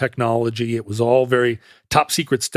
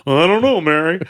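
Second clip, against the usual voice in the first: hammed-up sitcom character voice, higher and louder; Ted Baxter; 3 semitones higher, 4 dB louder, faster than usual. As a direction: play the character addressing a colleague, big and high. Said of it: "doing a Ted Baxter voice".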